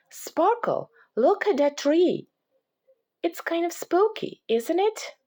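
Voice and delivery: put-on high, excited girl voice pointing things out; little girl voice, curious and playful; bright little girl Lucy. A narrator's reading. Lucy voiced in read story.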